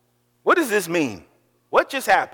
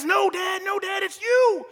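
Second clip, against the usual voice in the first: put-on higher, urgent kid voice; insistent son; 12 semitones higher than usual. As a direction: plead with Dad, he's got it wrong.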